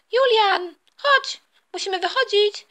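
high, urgent call to son. Acted. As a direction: pitch it high to summon him. Mother calls son to leave.

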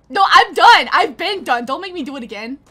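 loud, fed-up refusal; exasperated outburst at the game; exasperated protest. Real, not acted. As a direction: protest loudly, pitch jumping up. Refusing to redo the drill.

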